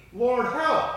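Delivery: high, loud plea to God; cry for help. A narrator's reading. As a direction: cry out for rescue as if in trouble. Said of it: "they cried in their trouble".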